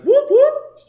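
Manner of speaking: very high, loud siren imitation; police siren whoop. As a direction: wail it like a cop car. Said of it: "so it looked like cops".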